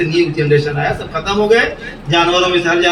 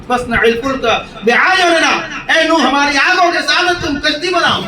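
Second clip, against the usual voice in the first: divine command; 6 semitones higher than usual. Retold or imitated. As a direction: proclaim the command with force. God's command recited and translated. (بلند آواز میں اعلان)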